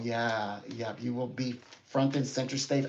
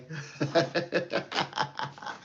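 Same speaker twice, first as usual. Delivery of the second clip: breathy laugh; 5 semitones higher than usual. Real, not acted. Breathy laugh after goodbye.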